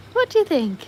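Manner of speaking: very high, playful question; high sing-song pet voice; pet-talk voice. Real, not acted. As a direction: ask it as if cooing to a dog.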